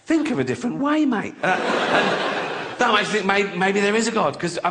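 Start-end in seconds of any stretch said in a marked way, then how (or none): 0.1-1.3 high-pitched, indignant objection; pained protest. Acted. Female cat to mating male, pained high protest. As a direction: protest in a higher voice.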